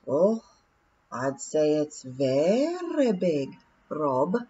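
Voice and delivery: slow, measured, thoughtful pixie; William's slow voice. Acted. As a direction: weigh every word deliberately. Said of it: "said William".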